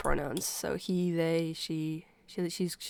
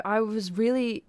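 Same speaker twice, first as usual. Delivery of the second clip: ordinary delivery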